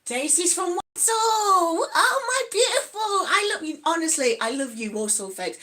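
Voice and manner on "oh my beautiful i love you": loud, high burst of delight; over-the-top affectionate cry; gushing adoration. Real, not acted. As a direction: squeal with love for a favourite person. Gushing over viewer's hometown and accent.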